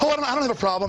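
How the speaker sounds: high nervous mock denial; fearful citizen. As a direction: squeak out a scared yes-man line. Voicing scared interviewees.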